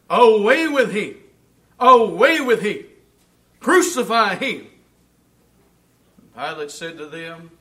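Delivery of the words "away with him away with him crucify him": loud, demanding crowd chant; crowd crying out. Retold or imitated. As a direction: shout it like an angry mob. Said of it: "cried out".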